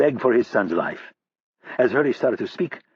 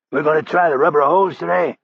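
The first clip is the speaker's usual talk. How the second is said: higher, louder sarcastic jab; a captive's cocky sneer; defiant prisoner; 5 semitones higher, 5 dB louder than usual. Acted. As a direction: toss the taunt back at him. Hurley mocks his torturer.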